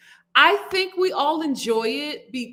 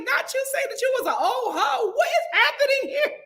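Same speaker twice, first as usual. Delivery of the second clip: high-pitched stunned disbelief; shocked disbelief; 13 semitones higher than usual. Retold or imitated. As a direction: squeal out your shock at the grandma. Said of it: "I was like".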